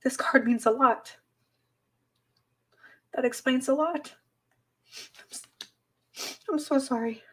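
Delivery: high, soft tearful voice; words coming through tears; choked up. Real, not acted. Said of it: "It's so hard for me to form words right now".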